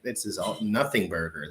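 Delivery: ordinary talk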